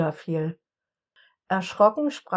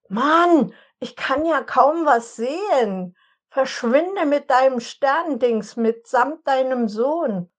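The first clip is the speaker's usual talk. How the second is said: higher, louder, irritated shout; Edward, blinded by the shooting star, yelling at Jesse; annoyed outburst; 5 semitones higher, 4 dB louder than usual. A narrator's reading. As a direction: drive the intruder away. Dazzled storm yells at Jesse.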